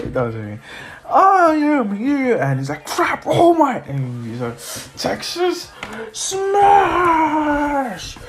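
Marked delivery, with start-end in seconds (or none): 1.1-2.4 high, slow, drawn-out hero call; heroic entrance. Acted. Hero announces his arrival. 2.8-3.8 high, drawn-out cry of alarm; alarmed villain. Acted. Villain sees the hero. 5.0-8.2 high, hugely drawn-out attack call; battle cry. Acted. Hero shouts attack move.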